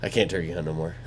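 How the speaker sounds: low, soft mock gloom; understated forlorn admission; mock lament. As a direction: say it small and low, half-joking. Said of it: "be like".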